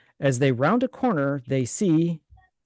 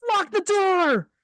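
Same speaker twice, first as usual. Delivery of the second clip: high, slow urgent order; high panicked order; urgent command; slower than usual. Acted. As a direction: bark it in terror. Frank gives urgent order.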